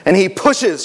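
ordinary delivery

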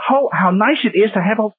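high-pitched mock non-Southern accent; imitated out-of-region accent. Southern preacher mimicking transplanted talkers in Alabama, mock out-of-region accent. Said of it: "talking like that down here".

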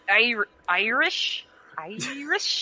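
slow, drawn-out "Irish" with a rise; doubtful questioning. Real, not acted. Speaker unsure which accent it was, questioning "Irish" slowly.

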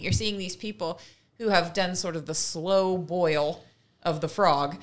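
normal talking voice

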